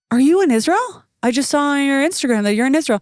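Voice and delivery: high, loud gushing greeting; excited shaman. Retold or imitated. Shaman gushing to her over the phone, high and excited. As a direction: gush over the news like an eager fan.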